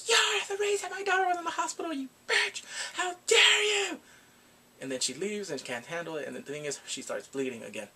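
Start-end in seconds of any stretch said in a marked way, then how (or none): 0.1-4.0 high shrieking mock outrage; furious blaming. Mom blames Eunseok at the exhibit, high hammed-up fury. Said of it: "she blames her".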